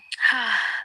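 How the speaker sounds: a long, loud sigh before answering; heavy sigh. Real, not acted. AI companion to her user before a hard answer, heavy sigh. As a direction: breathe out heavily, weary.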